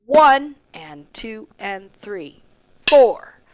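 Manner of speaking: "one" strong, "four" punched loud; accents on one and four. Shown as a demonstration. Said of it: "try to get the".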